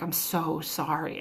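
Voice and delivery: soft, slow, hushed sorry; quiet apology. Retold or imitated. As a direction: pilot quietly apologizing to the flight attendant. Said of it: "he heard him say pretty quietly".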